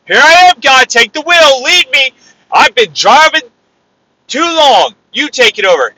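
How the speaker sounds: loud, high-pitched plea of surrender; impassioned shouted prayer, stretching the words; crying out to God. Acted. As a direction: call up to God with full force. Acts out surrendering to God aloud.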